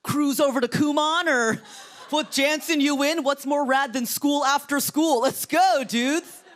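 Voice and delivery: loud, high-pitched skater dude. Acted. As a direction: hype up the crew like a hammed-up skater.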